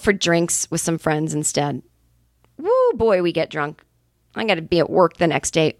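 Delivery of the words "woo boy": excited whoop. Retold or imitated. Recalling a wild drinking night.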